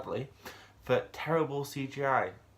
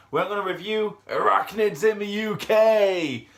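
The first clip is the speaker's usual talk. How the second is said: loud, high, drawn-out episode title; big title announcement; 10 semitones higher, 9 dB louder than usual. Acted. Reviewer announcing this week's episode, loud showman's call.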